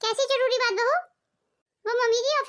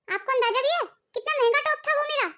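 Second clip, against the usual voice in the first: ordinary delivery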